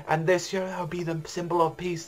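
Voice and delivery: high, ringing herald's cry; screaming proclamation. Retold or imitated. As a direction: announce the execution to the crowd. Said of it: "he's like".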